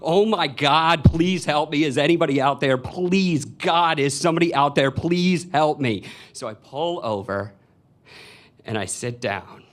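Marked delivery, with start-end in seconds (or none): 0.0-6.0 loud, higher-pitched cry for help; imitated woman screaming. Woman begging any passerby for help, screaming. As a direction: act out a woman screaming for rescue. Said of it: "it's this woman screaming".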